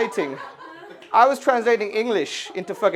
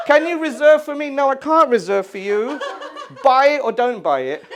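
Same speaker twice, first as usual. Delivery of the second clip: fed-up retort; 7 semitones higher, 5 dB louder than usual. Acted. Mocking buyer then snapping back.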